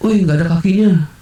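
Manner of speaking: high imitated kid calling out; small child. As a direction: mimic a child alerting friends. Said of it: "ada satu anak kecil".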